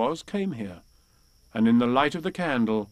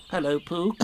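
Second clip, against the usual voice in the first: lightly voiced small creature, higher; a hint of a little piglet; 5 semitones higher than usual. A narrator's reading. Piglet greeting Pooh at the door, small and higher. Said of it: "said Piglet".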